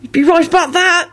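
squeaky, anxious agreement; strained high voice, placating; high-pitched squeak. A narrator's reading. Ron nervously agreeing with Kingsley, high-pitched squeak. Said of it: "Ron squeaked in a high-pitched voice".

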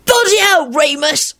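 put-on very high whining boy voice; loud whine. A narrator's reading. Victor complaining to Remus, high whine. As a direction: whine it like a fed-up youngster. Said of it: "Victor whined loudly".

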